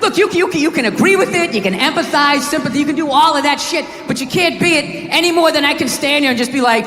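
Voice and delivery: ordinary talk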